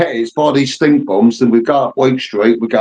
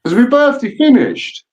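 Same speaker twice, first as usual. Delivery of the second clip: mock surprise; 12 semitones higher than usual. Real, not acted. Mock dismay over birthday ending.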